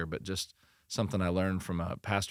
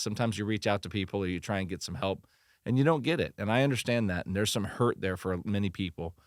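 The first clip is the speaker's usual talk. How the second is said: usual speaking voice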